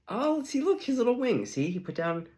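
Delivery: high, thrilled gushing; excited high voice. Retold or imitated. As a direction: point it out all excited and high. Excited friend voice.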